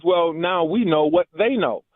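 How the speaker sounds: ordinary talk, nothing marked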